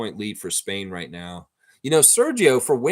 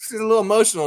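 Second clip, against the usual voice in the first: high, loud, laughing voice; amused laughter; 11 semitones higher, 7 dB louder, much faster than usual. Real, not acted. Commentator amused by the fuming coach, laughing loudly. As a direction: say it through a burst of laughter.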